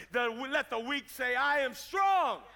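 slow build into a loud, drawn-out shout; preaching declaration. Acted. Preacher leading the weak to say they are strong, shouted declaration. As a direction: declare it slowly and forcefully, swelling at the end.

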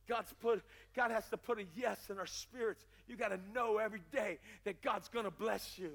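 ordinary delivery

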